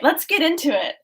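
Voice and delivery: ordinary talk, nothing marked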